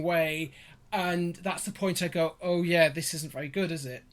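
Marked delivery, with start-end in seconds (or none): none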